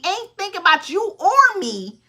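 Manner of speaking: ordinary talk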